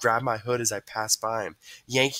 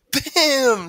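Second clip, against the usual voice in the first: high, loud punchy exclamation; gleeful loud interjection; excited sound effect; 9 dB louder than usual. A narrator's reading. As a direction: throw it like a fake punch. Boys acting out the tackle.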